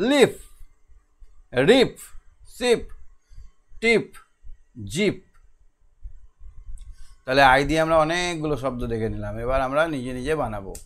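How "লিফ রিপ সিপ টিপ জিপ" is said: each word sung out high and loud for the class; a teacher's high call-and-repeat model. Shown as a demonstration. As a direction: say it clearly so the children can repeat. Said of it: "সবাই বলো আমার সঙ্গে".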